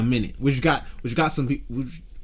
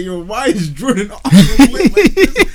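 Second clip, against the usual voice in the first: high, loud, slow laughing disbelief; 6 semitones higher, 10 dB louder, slower than usual. Retold or imitated. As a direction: crack up while asking, slowly. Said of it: "I was like".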